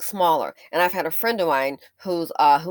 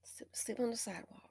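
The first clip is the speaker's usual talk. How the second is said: unvoiced whispered aside; 3 semitones higher, 17 dB quieter than usual. Real, not acted. Vlogger whispering about people sleeping on sidewalks. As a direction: breathe it out in disbelief.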